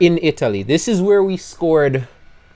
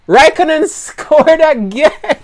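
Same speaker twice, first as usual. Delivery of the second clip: much higher, slow, laughing through the words; laughing in disbelief; 6 dB louder, slower than usual. Real, not acted. Team boss watching the wrong driver score, laughing high. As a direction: crack up mid-sentence. Laughs at Kimi scoring again.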